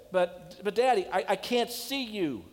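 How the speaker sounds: put-on a frightened child calling down; scared little boy. Frightened boy answers from fire.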